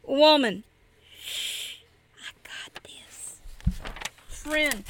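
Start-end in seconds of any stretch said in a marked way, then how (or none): 1.2-3.4 whisper. Acted. Woman's repeated whispered refrain.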